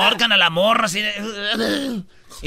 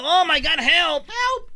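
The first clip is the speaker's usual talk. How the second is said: mock panic; 7 semitones higher, slower than usual. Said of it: "la ruca gritando".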